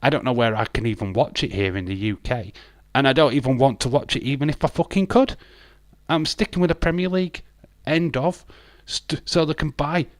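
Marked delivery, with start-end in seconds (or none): 2.9-10.1 rising, faster angry rant. Real, not acted. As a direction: spit it out, worked up. Rant builds up.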